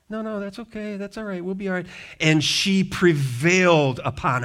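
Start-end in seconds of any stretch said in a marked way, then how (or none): 0.1-1.9 polite refusal. Retold or imitated. Apostles politely declining Lydia's offer.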